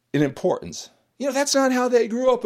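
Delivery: ordinary talk, nothing marked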